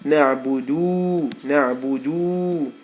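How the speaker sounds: Na'budu said slowly, each syllable drawn out; slow demo. Shown as a demonstration. Teacher sounding out the misread word slowly. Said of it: "it looks like it might say".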